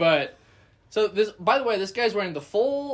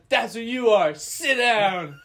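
heckler taunt; 5 semitones higher, 4 dB louder, slower than usual. Retold or imitated. Heckler mocking the cousin.

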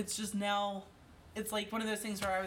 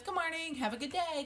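put-on toddler voice; 8 semitones higher than usual. Mother imitates her toddler son.

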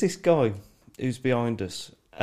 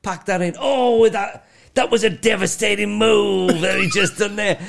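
building to a high, loud call; wrestling commentator; 6 semitones higher, 3 dB louder than usual. Retold or imitated. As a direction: imitate a fan commentating, rising to outrage. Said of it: "he carries on repeating".

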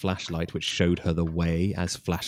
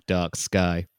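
normal talking voice

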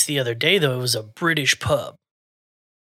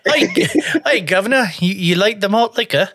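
high, laughing attempt at British; British accent; 6 semitones higher, 3 dB louder than usual. Shown as a demonstration. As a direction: stumble through a hammed-up British accent. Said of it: "hear a British accent now".

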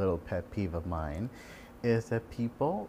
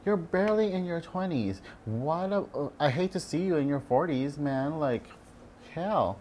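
much higher, sassy mock scolding; higher, hammed-up scolding of young patients; sassy rant; 7 semitones higher than usual. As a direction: sass them like a friend. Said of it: "I'm like".

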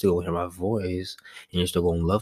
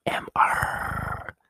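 breathy ASMR whisper into the mic; much slower than usual. Real, not acted. Starting an ASMR bit for podcast listeners, whispered. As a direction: hush it right down, spelling the letters out. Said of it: "Let me do a little ASMR".

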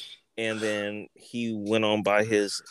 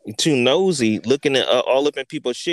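angry telling-off; 3 semitones higher, 6 dB louder than usual. Retold or imitated. Yelling at nosy neighbor's son.

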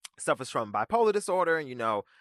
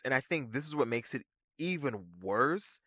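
ordinary delivery

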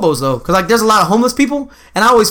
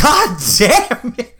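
laughing exasperation; 5 semitones higher, much slower than usual. Retold or imitated. Retold moment at a gas station.

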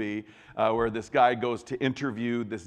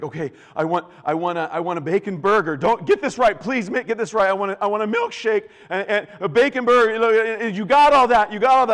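frantic high, loud order, building; panicked; 9 semitones higher, 10 dB louder than usual. Acted. Condemned man giving his last meal order to the guard, panicked. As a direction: plead in a rising panic to get it right. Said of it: "and he's panicked".

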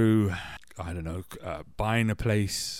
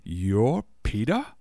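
slow, puzzled mock question; 4 dB louder, slower than usual. Man waiting for Peter finally sees him, puzzled. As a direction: draw it out in hesitant disbelief. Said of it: "puzzled look on his face as if to say".

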